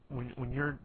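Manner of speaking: ordinary delivery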